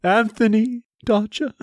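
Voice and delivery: high, slow, grand Shakespearean declaiming; classical stage acting. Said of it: "get some Shakespearean awesomeness".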